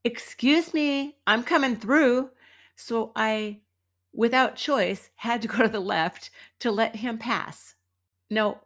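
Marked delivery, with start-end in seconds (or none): 0.0-2.3 calling out. Retold or imitated. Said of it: "he calls out".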